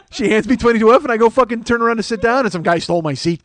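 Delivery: normal talking voice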